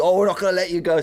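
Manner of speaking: high, clingy drunk-friend impression; put-on drunken pleading; drunk mate. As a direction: hang on like a drunk pal. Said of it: "there's the drunk mate".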